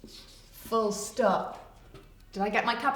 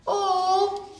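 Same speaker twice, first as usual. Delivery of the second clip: normal talking voice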